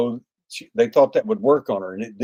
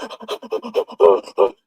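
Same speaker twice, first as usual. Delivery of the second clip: high, rapid mock gasping; imitated frightened gasps; 10 semitones higher than usual. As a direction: gasp repeatedly as if scared to death. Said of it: "she's scared to death".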